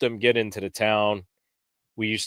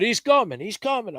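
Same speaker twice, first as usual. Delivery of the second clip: high-pitched imitation of yelling to a partner; mock yell, voice jumping up high; urgent heads-up, acted out without full volume; retold yelling; 12 semitones higher than usual. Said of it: "I'm yelling to him".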